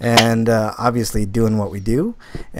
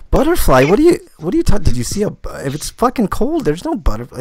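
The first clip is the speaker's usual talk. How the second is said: incredulous; 8 semitones higher than usual. Retold or imitated. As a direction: retell the disbelief, squeaking up high. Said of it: "I was like".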